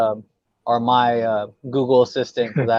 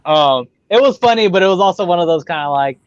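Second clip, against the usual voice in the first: normal talking voice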